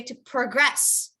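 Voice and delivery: to progress stressed on GRESS; stress on second syllable. Shown as a demonstration. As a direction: lean on the end of progress, the verb. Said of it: "the verb".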